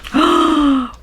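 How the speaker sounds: loud, high gasp of surprise; delighted gasp. Real, not acted. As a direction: gasp as if you just found treasure. Spots fan art in the book.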